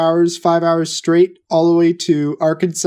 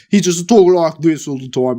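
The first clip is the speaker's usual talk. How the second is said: hammed-up mock Cockney; thick Cockney accent; 4 dB louder than usual. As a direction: imitate a Cockney talker, laying it on thick. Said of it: "a really thick Cockney accent".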